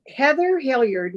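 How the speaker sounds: usual speaking voice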